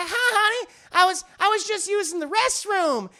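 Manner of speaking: flustered Southern wife, hammed up; Bridget. Wife greets husband at the door with an excuse, high Southern accent. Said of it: "She has a very deep Southern accent".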